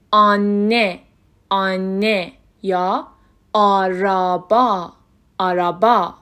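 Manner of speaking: "آنه" and "آرابا" slowly, with long open ah; open "آ" sound. Shown as a demonstration. Teacher giving examples of the first vowel, "آرابا" slow with open ah. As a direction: stretch the ah in "آرابا". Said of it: "این حرف همیشه".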